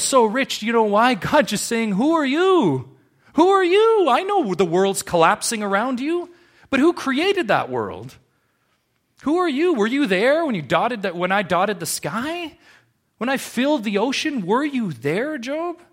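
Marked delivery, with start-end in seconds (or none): 1.9-3.0 high, loud voice of God questioning Job; God confronting Job, loud and high; booming God. Acted. God challenging Job in sermon. 3.3-4.1 "Who are you?" shouted very high and loud; God demanding "Who are you?" at full volume; thundering God. Acted. God challenging Job, repeated. 4.1-15.8 high, loud voice of God rebuking Job; the Creator pressing his challenge; God reminds Job who made the world; booming God. Acted.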